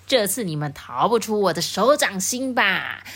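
a hint of a sneering wolf; cold sneer. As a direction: gloat over the trapped piglets. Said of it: "就发出冷笑".

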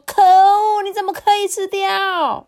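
very high, loud indignant cry; mother's outraged shout; furious loud outburst. A narrator's reading. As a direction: explode at the culprit, voice shooting up. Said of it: "妈妈气得跳起来， 大声的说".

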